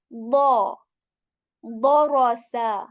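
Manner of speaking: "Bo" loud and heavy, mouth full. Shown as a demonstration. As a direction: fill the mouth on "Bo". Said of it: "full mouth, heavy sound".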